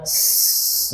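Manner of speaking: a voiceless "s-" hiss with escaping air. Shown as a demonstration. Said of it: "mendesis bacaannya".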